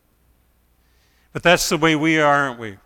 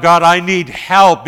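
loud, slightly slow plea; preacher's loud desperate call; crying out to God; 4 semitones higher, 10 dB louder, slower than usual. Acted. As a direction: cry up to God with full force. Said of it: "I cry out".

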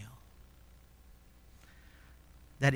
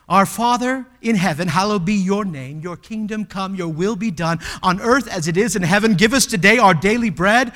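loud, higher recited prayer; preached prayer; 4 semitones higher, 9 dB louder than usual. Acted. Preacher leading the congregation in the Lord's Prayer, loud proclaiming.

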